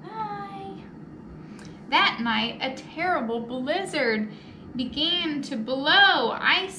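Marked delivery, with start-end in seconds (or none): none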